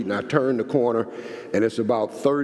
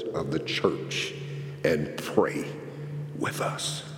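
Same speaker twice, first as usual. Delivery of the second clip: hushed plea; 4 semitones lower, 5 dB quieter, slower than usual. Retold or imitated. Men's quiet request, retold.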